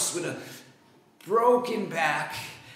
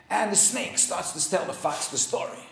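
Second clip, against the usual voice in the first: ordinary delivery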